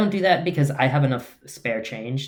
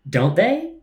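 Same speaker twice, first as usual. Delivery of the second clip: put-on Romanian accent, higher and louder; thick Romanian accent; 8 semitones higher, 5 dB louder than usual. Professor seeking validation from his American student, thick Romanian accent. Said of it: "in a thick Romanian accent goes".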